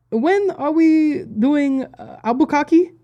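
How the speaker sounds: put-on high, slow French-accented woman; imitated French accent. French tour manager calling from the back of the van, imitated French accent. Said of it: "it was Albuquerque is how she said it".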